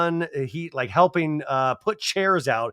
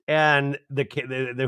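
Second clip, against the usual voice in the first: normal talking voice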